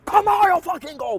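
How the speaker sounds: loud high-pitched terrace yell; retold shout. Fan shouting at the keeper from up a tier, shouting. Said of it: "and I'm shouting".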